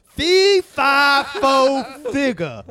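booming giant chant, slow and high; giant's fee fi fo. Acted. Friends joke she feels huge, giant's fee fi fo. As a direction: boom it out like the giant from the beanstalk. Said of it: "smell the blood of an Englishman".

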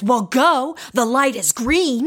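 retold angry driver. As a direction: snap it at him like an irritated driver. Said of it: "He's still really angry".

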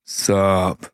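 low, laid-back sup; cool deep voice. Acted. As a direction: drop into a cool new voice. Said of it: "He even sounds different".

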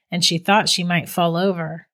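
usual speaking voice

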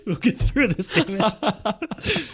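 words breaking into laughter, pitch jumping high; can't keep it together while reading; laughing through the line. Real, not acted. Reading the story aloud to his co-host, laughing through it.

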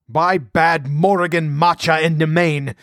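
indignant barbaric oath; wrathful oath. A narrator's reading. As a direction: swear by the gods in wrath. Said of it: "he concluded wrathfully".

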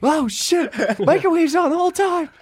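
mock panicked realization. Teacher realizing microwave still running.

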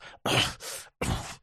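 wordless, voiceless puffs of breath; demonstrative coughing. Shown as a demonstration. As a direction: answer only with coughs and breath. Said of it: "All you're gonna hear is".

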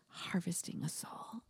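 conspiratorial whisper. Real, not acted. HR boss whispering the dark truth to the candidate. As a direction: lean in and whisper the secret. Joking aside about firing people.

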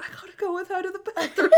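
high, giggly mock plea; barely holding back laughter on the punchline; laughing through the quote. Retelling her favorite sitcom joke, laughing high.

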